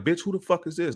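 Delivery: high-pitched, indignant mock demand; retold cursing. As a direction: act out cursing her out. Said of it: "cursing her, like".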